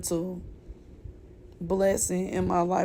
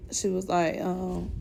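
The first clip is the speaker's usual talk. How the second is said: usual speaking voice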